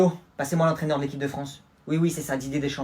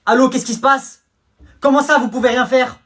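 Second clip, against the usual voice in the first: panicked shout; 10 semitones higher, 10 dB louder than usual. Acted. Prince panicking on phone.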